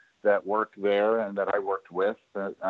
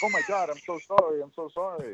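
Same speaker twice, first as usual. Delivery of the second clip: flustered apology; 8 semitones higher, 3 dB quieter than usual. Retold or imitated. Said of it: "And I'm like".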